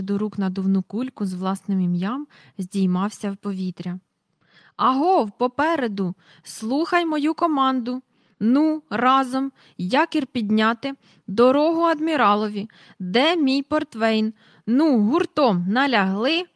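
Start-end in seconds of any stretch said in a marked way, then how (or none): 4.8-16.4 mighty sea-worn admiral. Acted. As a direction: bellow orders across the deck. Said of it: "Залунав могутній, загартований у морі голос". (гучний повільний голос адмірала, що командує)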